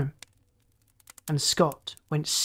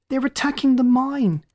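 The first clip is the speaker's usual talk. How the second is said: much higher, louder, "attacking" stressed; taunting shout; 9 semitones higher, 4 dB louder than usual. Acted. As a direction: yell "attacking" as a jeer. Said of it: "the lookout's voice taunted".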